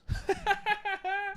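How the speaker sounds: soft high staccato laugh; quick high-pitched giggle, kept quiet. Real, not acted. Laughs at his own rant.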